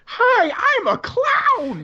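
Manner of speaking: very high, slow clown squeal; silly clown. Retold or imitated. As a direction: shout it like a goofy clown. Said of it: "Clown's voice is evidently kinda like this".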